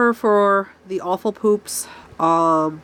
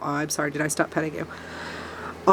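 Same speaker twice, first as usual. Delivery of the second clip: soft to the cat; 3 semitones lower, 7 dB quieter than usual. Real, not acted. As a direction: murmur sorry to the pet you stopped stroking. Softly apologises to the cat.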